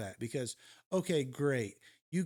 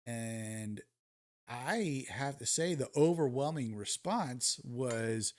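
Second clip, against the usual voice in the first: usual speaking voice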